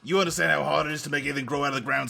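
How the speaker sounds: a mock tough Brit with a hint of a growl; hammed-up British tough guy; bad British accent. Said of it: "a really bad Jason Statham impression".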